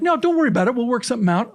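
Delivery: high, breezy reassurance. Retold or imitated. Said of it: "everybody's like".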